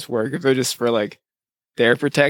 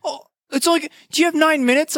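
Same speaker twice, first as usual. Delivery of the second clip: high, wheedling mock kid voice; mocking the salesman; 13 semitones higher, faster than usual. The solar kid asking for nine minutes, high and wheedling. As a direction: play the pushy kid, pitched way up.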